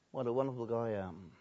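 usual speaking voice

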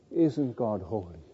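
low, soft awed question; hushed reverence. Acted. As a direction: drop low and soft in awe. Awed question after the angels' holy.

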